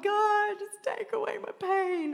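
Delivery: lightly hammed-up complaining prayer; mock plea. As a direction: beg like a frustrated child. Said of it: "I'm like".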